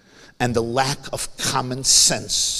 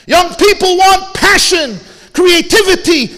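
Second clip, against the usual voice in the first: high, loud, impassioned sermon voice; fiery preaching; 12 dB louder than usual. Acted. Rabbi rousing mourners at the eulogy, high and loud preaching. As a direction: preach it at full force to the crowd.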